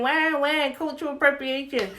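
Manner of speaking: put-on crybaby wail, lightly mocking; mock whining. Mimicking complainers about cultural appropriation.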